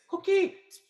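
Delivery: high squeaky frog voice. Pretend. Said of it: "what they're saying is".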